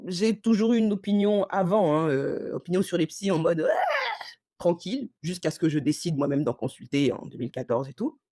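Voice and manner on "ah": very high mock shriek of dismissal; short, high, scornful yelp; dismissive squeal. Mock reaction to therapists.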